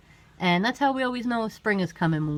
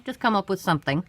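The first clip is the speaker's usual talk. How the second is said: normal talking voice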